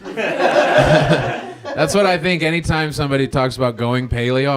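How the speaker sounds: ordinary delivery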